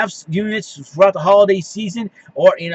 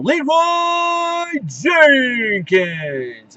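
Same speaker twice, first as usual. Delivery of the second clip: battle cry yell; 7 semitones higher, much slower than usual. Shown as a demonstration. Host tells viewers on a bad day to yell it, drawn-out battle cry. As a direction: charge in with it, hold the name as long as you can. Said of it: "You can either yell".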